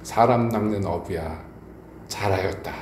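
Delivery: low, slow, measured words of Jesus; lightly voiced Jesus. Jesus appears to the aged Peter, lightly voiced, low and slow. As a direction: softly intone it, low and drawn out, lightly acted. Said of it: "예수님이 나타나셔서 그런 거예요".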